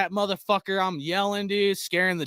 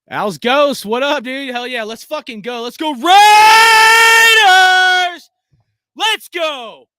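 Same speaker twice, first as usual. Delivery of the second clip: louder and higher with rising excitement; hyped cheering; 9 semitones higher, 13 dB louder than usual. Real, not acted. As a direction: pump up the chat with a cheer. Hyping with chat after the win.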